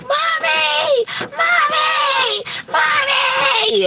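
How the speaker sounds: put-on very high, desperate child's scream; a terrified boy crying for his mother; shrill pleading cries for mommy. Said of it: "screaming like".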